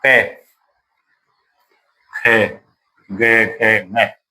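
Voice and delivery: कै, खे, गे, खे, मै each said separately and clearly; teacher demonstrating velar sounds कै खे गे; sounding out consonants. Shown as a demonstration. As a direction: sound out कै खे गे one by one from the throat. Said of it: "मैं ये बोलता हूँ".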